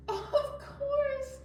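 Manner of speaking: high, squeaky little tiger puppet; cheerful puppet voice. Pretend. Host voicing Tiger the puppet for the kids. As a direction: play the eager puppet friend.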